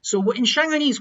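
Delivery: ordinary talk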